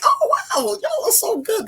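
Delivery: high-pitched mock gushing. Daughter retelling her reaction to parents reading her book, gushing. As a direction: squeal it in delighted amazement, playfully.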